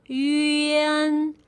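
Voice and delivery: yuan drawn out slowly, gliding from yu through e to n; blended slow yuan. Shown as a demonstration. Teacher putting the sounds together for learners, slow gliding yuan. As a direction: stretch yuan so each blended sound shows. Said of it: "the combination of yu plus e".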